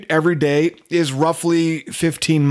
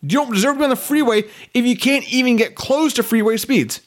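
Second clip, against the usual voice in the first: higher-pitched exasperated tirade; angry rant; 5 semitones higher than usual. Real, not acted. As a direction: rail at them in exasperation. Ranting at slow drivers.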